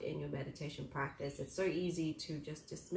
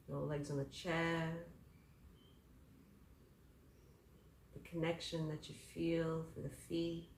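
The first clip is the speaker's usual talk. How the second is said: guided meditation; 6 dB quieter than usual. Acted.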